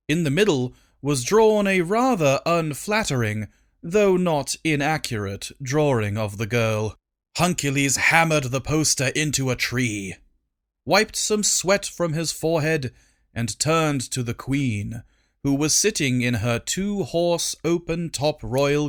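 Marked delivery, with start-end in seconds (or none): none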